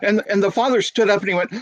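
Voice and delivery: usual speaking voice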